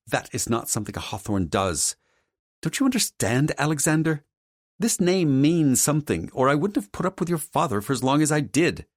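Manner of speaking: high, haughty mother scolding; indignant mother character. Acted. Mother defends the family name to her son, indignant high voice. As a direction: lecture as the proud family matriarch.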